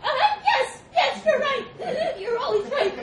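squeezed, very high panicked squeal; gasping monkey. A narrator's reading. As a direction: gasp out agreement while being crushed. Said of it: "gasped the monkey".

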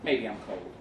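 usual speaking voice

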